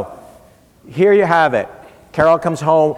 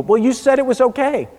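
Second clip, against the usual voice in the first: put-on teen protest; 9 semitones higher than usual. Daughter answering mother.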